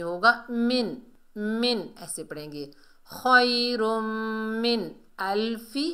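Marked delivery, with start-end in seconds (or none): 0.5-1.9 मिन with a clean n, no hum or stretch; clear nun. Shown as a demonstration. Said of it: "गुन्ना, मद, इक्फा कुछ भी नहीं". 3.1-5.9 slow chant with a held nasal hum; Quran recitation. Shown as a demonstration. Recites phrase being taught.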